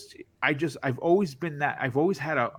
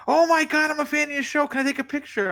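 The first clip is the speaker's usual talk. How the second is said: much higher, louder gushing fan voice; excited fan; 4 dB louder, faster than usual. Retold or imitated. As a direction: squeal it like a starstruck fan. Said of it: "people be like".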